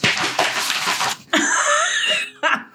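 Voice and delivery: breathy high-pitched laugh. Real, not acted. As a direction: burst into a squeaky laugh. Laughs at absurd news.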